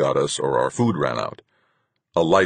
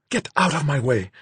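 high, urgent hissed command; 11 semitones higher than usual. A narrator's reading. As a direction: hiss it, urgently, to clear the way. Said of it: "she hissed urgently".

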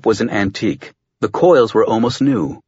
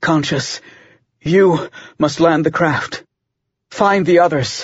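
breathing with exertion; 5 semitones higher than usual. Acted. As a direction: gasp the words through the strain. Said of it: "now breathing with exertion".

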